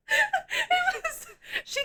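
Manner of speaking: very high laughing cry; squealing laugh. Real, not acted. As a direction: laugh out the words. Laughing at the scene.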